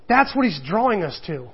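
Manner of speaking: ordinary delivery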